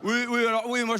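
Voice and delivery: ordinary delivery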